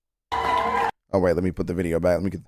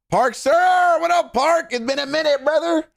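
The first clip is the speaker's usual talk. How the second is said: excited greeting; 4 dB louder than usual. Real, not acted. Greeting raider on stream.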